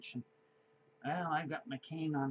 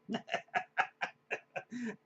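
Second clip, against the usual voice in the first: laughing; 8 semitones higher than usual. Real, not acted. Teller laughs at memory.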